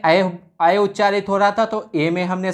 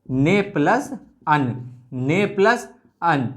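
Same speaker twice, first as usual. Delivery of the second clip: 1 slow split; much slower than usual. Shown as a demonstration. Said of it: "संधि विच्छेद इसका कैसे होता".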